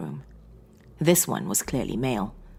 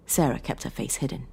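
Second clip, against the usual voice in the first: ordinary delivery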